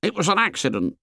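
put-on high boy's realisation; abrupt, high-pitched excuse; sudden assertion. A narrator's reading. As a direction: blurt it out in a high voice. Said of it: "said Piggy suddenly".

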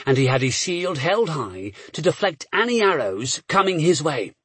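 usual speaking voice

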